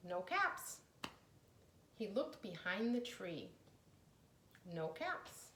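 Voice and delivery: plain reading voice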